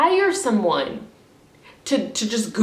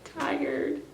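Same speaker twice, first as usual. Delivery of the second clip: high, breaking voice through tears; crying; 9 semitones higher, 4 dB louder, slower than usual. Real, not acted. Venting to viewers on camera, breaking down in real tears. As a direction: sob out how exhausted you are. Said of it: "I'm crying because".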